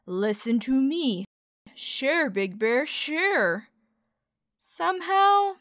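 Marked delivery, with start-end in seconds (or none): none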